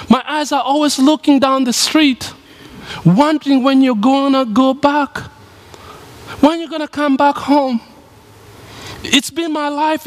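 a touch of tearful father; lightly voiced crying dad. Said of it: "father cries".

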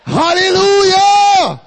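huge high-pitched hallelujah shout; very loud, drawn-out church cry; shouted praise. Real, not acted. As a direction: belt out praise at full voice, holding the vowels long. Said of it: "shout a praise to our God".

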